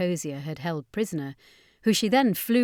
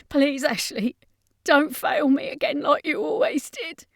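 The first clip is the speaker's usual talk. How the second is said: higher, pleading whine; whimpering; 8 semitones higher than usual. Acted. Defeated vampire brother begs his sister, whimpering. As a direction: beg pitifully for your life. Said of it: "Brian whimpered".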